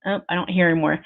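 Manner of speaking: normal talking voice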